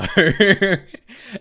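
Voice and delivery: loud, high burst of laughter; a loud, high laugh that rises at the end. Real, not acted. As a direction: break out in a big real laugh. Laughing at the Indian accent joke.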